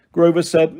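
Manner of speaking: plain reading voice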